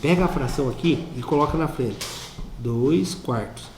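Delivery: hushed, as if letting out a secret; speaking very low. Shown as a demonstration. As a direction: drop to a low, soft confidential voice. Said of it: "vou falar bem baixinho".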